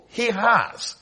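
ordinary delivery

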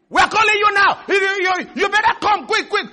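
high, loud pressuring shout; acted creditor barking orders; demanding debt collector. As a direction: hound them for money, high and urgent. Acting a demanding debt collector phoning.

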